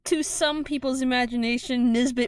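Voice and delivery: mock epic narration. GM to players, mock epic narrating Nisbet's departure. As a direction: narrate the loss like a legend.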